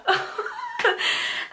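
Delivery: a squeaky delighted laugh; very high giddy laugh. Real, not acted. Telling viewers about her pregnancy, giddy high laugh. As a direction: let the joy spill out in a high giggle.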